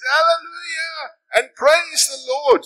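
very high, loud, slow shout of praise; cried out. Retold or imitated. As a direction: let the praise ring out to the whole park. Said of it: "cried out again".

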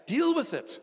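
high, loud blunt command. Real, not acted. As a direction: bark it out to the congregation.

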